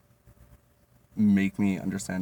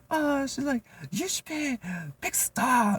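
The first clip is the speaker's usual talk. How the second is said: put-on much higher, slow accented coworker voice; imitating coworker; slower than usual. Coworker flattering him, high slow mock accented voice.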